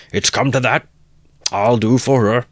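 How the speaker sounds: loud, angry shout; murderous rage. Acted. Mikulka vowing to kill the mare, angry shout. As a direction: threaten through fury.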